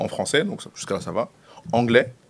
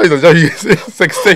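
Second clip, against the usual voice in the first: laughing through it; 7 semitones higher, 8 dB louder than usual. Real, not acted. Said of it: "j'ai un accent de merde".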